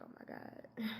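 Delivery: whispered oh-no reaction; hushed, unvoiced mock panic; whispered dread. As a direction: whisper it under your breath, re-enacting dread. Retold dread at principal's summons.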